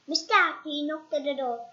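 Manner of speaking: ordinary delivery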